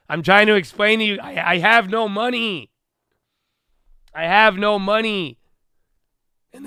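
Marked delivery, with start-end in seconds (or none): 0.1-5.4 higher, louder, pleading disbelief; exasperated complaint. Real, not acted. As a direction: push it up like nobody's listening. Exasperated about having no money.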